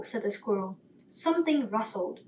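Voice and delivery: usual speaking voice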